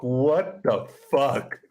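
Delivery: slow, stretched-out inner scream; screaming inside. Retold or imitated. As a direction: draw out each word in disbelief. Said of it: "just screaming inside of myself".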